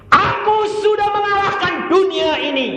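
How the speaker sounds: high, shouted declaration; thundering preacher quoting Jesus; triumphant proclamation. Acted. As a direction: proclaim victory at full force.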